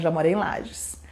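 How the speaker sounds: whisper. Real, not acted. Aside about living in Lages.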